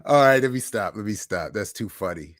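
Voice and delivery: ordinary talk, nothing marked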